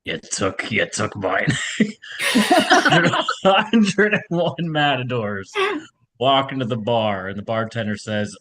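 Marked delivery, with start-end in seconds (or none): 1.8-5.5 real laughter spilling into the words; laughing while talking. Real, not acted. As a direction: start the joke still cracking up, higher. Cracks up starting own joke.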